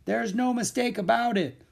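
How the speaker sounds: high-pitched call back. A narrator's reading. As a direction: call it back over your shoulder. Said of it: "Louis called back over his shoulder".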